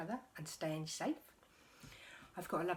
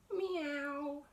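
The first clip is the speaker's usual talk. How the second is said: cat meow; 9 semitones higher than usual. Acted. Cats on the wall.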